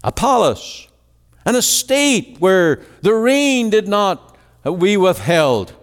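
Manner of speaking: high, driving pulpit cry pleading with the congregation; impassioned preaching. Acted. Preacher crying out to the congregation about God's plan, impassioned preaching. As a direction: preach it at full force, voice lifted high.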